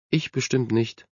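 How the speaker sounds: softly downcast; a touch of sadness in a small voice; sad. A narrator's reading. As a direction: answer with quiet resignation. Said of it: "entgegnete Emily traurig".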